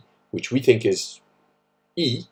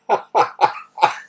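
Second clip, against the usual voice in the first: amused laugh; 10 semitones higher, 5 dB louder than usual. Real, not acted. Reacts to story.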